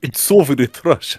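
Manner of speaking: botched attempt at a Russian accent; half-hearted Russian accent; failed Russian accent. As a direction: try Russian and miss. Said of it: "I can't do a Russian accent".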